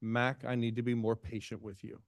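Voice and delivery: ordinary delivery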